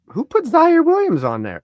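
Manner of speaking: high, loud surprised question; startled demand. Real, not acted. One player to co-host about an unexpected roster name, startled demand. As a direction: call it out in disbelief.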